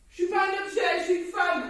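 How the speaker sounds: loud, outraged protest; angry. Real, not acted. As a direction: demand respect furiously. Furious protest.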